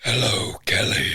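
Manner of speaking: low, seductive hello; sexy voice. Pretend. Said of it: "That was my sexy voice".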